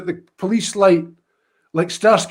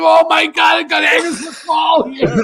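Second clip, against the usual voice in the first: high, loud, delighted shout; a cop shouting his delight at meeting a famous piper; excited shout; 11 semitones higher, 5 dB louder than usual. Retold or imitated. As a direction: yell it like a thrilled fan. Cop thrilled by another piper.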